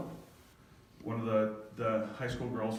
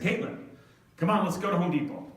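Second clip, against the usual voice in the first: higher, louder, upbeat urging; coaxing call; 6 semitones higher, 6 dB louder than usual. Retold or imitated. Dad urging his daughter to come to Home Depot, coaxing call. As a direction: call out to get someone moving.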